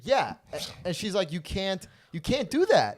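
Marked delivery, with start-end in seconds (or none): 1.3-3.0 high mocking girl voice. Gigi scolding him, retold.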